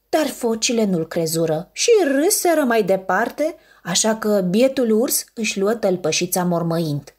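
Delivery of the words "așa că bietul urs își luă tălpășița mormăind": plain reading voice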